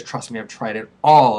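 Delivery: usual speaking voice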